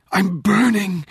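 high-pitched cry of pain; anguished scream. A narrator's reading. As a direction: cry out as if scalded, voice leaping high. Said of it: "Rachel's scream was a whisper".

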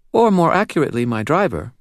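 put-on high, serious child's voice with a faint British lilt; serious little girl, faint British accent. A narrator's reading. Narrator voicing the girl to Dresden, serious and high. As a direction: speak like a grave little girl.

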